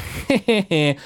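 high mocking villain chuckle; villain laugh. Murdoch laughing at Blorgzak, high mocking villain chuckle. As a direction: laugh down at the fool.

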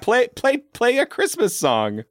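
character voice. Acted. Acting the character's request.

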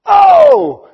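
very high, loud, held yelp; excited shout "Oh!". Retold or imitated. Peter realising it is the Lord, excited yelp. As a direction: yelp as you suddenly recognise someone. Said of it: "Peter goes".

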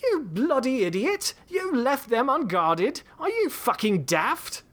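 put-on high, slow British-accented outburst; English Bob aiming a derringer at the sheriff, furious; shouting. A narrator's reading. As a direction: yell it at him in fury.

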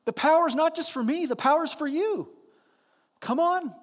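high, loud, cheerful come on; high loud welcoming Jesus voice; eager invitation. Retold or imitated. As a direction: wave Peter out, bright and eager. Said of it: "It's like".

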